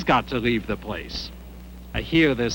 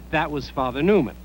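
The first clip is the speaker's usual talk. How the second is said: ordinary delivery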